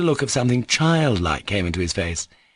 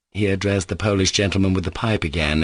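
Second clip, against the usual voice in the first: plain reading voice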